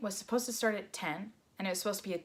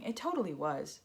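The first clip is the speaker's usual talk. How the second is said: sarcastic; 2 semitones lower, 5 dB quieter than usual. Shown as a demonstration. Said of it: "Oh yeah, 'cause that's the first thing".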